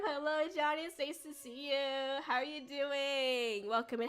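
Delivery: ordinary talk, nothing marked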